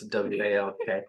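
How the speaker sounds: W A L K spelled letter by letter. Shown as a demonstration. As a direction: spell W A L K so the dog won't catch it. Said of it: "our dog heard the magic words".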